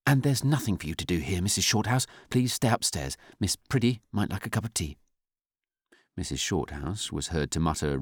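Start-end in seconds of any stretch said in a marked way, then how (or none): 0.0-5.0 higher-pitched, firm instruction; firm senior nurse's dismissal; brusque authority. A narrator's reading. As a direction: send her off with brisk authority. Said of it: "Sister Ambrose went on".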